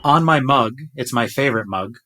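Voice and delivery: usual speaking voice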